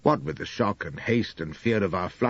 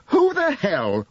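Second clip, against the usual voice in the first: higher, louder, slow furious roar; 14 semitones higher, 5 dB louder, slower than usual. Acted. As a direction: bellow it at his wife in a jealous rage. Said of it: "roars I".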